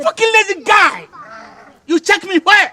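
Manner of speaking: high, loud, angry outburst; furious shout. Acted. Squaring up to a rival in a quarrel, furious shout.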